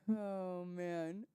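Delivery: very soft, faint "oh, man"; spent sigh. Real, not acted. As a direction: let it fade out after laughing hard. Winding down after laughing fit.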